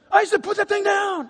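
high, urgent reprimand; scolding dad. Retold or imitated. Father telling his son off on the plane, sharp scolding. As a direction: imitate an exasperated father.

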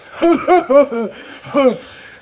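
mocking laugh. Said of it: "And that guy goes".

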